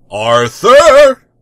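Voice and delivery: loud, high call from the bath. Acted. The tick interrupting from the bathroom. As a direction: holler his name. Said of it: "the tick's voice interrupted".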